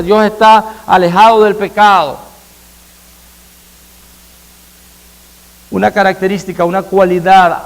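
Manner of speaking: loud, high, fervent sermon delivery, hammering each phrase; full-force preaching. Acted. Pastor proclaiming God's holiness to the congregation, full-force preaching. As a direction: preach it to the rafters, slowing on the big words.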